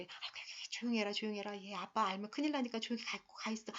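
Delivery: put-on soft, breathy hushing of the kids; a mother shushing the children so dad won't hear; hushed pleading mother. Mother hushing the children, softly pleading. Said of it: "이런 식으로 얘기하는 엄마".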